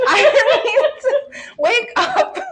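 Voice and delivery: high, through real laughter; cracking up mid-sentence; laughing. Real, not acted. Player cracking up over the accidental knockout, laughing.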